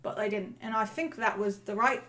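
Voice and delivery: put-on chatty Cockney accent; Cockney accent, in-character interview; Cockney lamplighter. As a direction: talk as a musing Cockney lamplighter.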